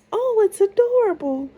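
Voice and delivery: high, bright princess exclamation. A narrator's reading. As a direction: gush like a delighted young princess.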